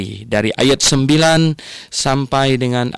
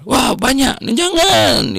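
high-pitched mock dismay at the amount; acted dismay; 12 semitones higher, much slower than usual. Grandfather balking at his grandchild asking for money, in high mock dismay. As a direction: balk in a much higher storytelling voice.

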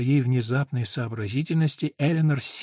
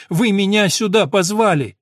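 raised, higher and louder protest; near shout; 7 semitones higher, 8 dB louder than usual. Acted. Eleanor protests to the doctor, nearly shouting. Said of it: "почти что выкрикнула Элинор".

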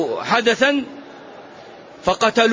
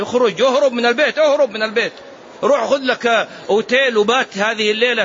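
higher-pitched, pressing advice; urgent urging; 5 semitones higher than usual. Acted. Preacher urging an angry husband to leave home, urgent. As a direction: hurry him out insistently.

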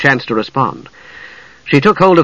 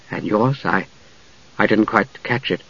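soft; 3 semitones lower, 6 dB quieter than usual. A narrator's reading. Palin shyly asks Usha's name.

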